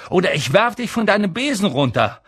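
fast, high threatening call; rufen. A narrator's reading. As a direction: fire off the threat quickly. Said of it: "rief Harry". (schnelle, laute Drohung)

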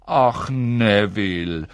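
long, slow, exasperated sigh of words; drawn-out grandmotherly sigh; sighing old woman. A narrator's reading. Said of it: "hörte er die alte Frau seufzen".

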